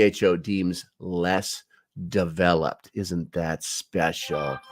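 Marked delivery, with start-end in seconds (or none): 2.9-4.6 slow, softer sarcastic aside. Real, not acted. Host comments on the treaty he reads, sarcastic aside. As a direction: draw it out with mock admiration.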